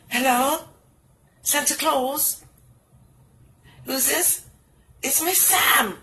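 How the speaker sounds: ordinary talk, nothing marked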